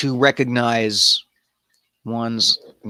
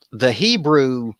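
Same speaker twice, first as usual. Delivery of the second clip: usual speaking voice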